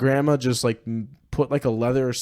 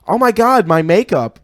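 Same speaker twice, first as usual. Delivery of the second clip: much higher, louder cry over the makeup; outraged yelp; 7 semitones higher, 8 dB louder than usual. Retold or imitated. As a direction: yelp in mock horror. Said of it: "and I go".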